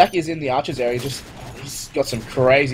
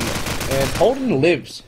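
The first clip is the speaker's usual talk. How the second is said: slow, drawn-out call of disbelief; amazed; much slower than usual. Real, not acted. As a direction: stretch it out in awe. Caster amazed a player survives.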